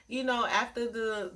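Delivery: normal talking voice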